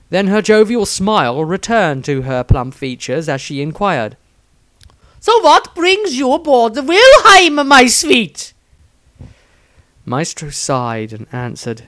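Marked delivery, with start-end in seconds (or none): 5.2-8.5 much higher, louder, slow swooning gush; an adoring nun cooing at the wizard she loves; gushing besotted nun. Acted. Nun inquires sweetly.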